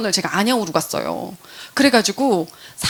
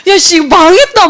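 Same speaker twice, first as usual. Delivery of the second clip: slow, loud, higher "ah crap, I'm done"; loud dismayed curse; 7 semitones higher, 10 dB louder than usual. Retold or imitated. Realizing the unni already uses Amway, a slow loud curse. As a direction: drag out the dismay loudly. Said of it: "막 이런 생각인 거예요".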